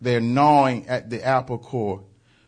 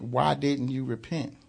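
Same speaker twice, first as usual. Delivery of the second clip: quiet reading of Christ's rebuke; 7 dB quieter than usual. Retold or imitated. Preacher rereading the Son's question, quiet and soft. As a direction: read it back gently to the listeners. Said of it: "He said".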